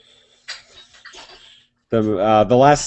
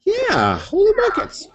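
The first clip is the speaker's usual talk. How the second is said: excited exclamation. Real, not acted.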